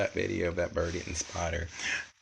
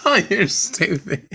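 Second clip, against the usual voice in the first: high, loud, slow laughing oh you're stupid; laughing tease; 12 semitones higher, 8 dB louder, slower than usual. Real, not acted. To a friend, a high laughing tease.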